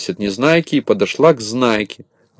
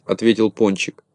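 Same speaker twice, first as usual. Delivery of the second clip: usual speaking voice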